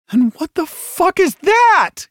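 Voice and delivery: high, loud outburst of disbelief; stunned, drawn-out yell; demanding shout. A narrator's reading. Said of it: "Costello demanded".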